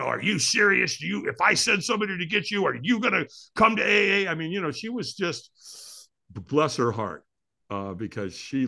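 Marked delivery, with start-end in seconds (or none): 0.0-4.3 brusque phone lady. Retold or imitated. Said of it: "And she's like".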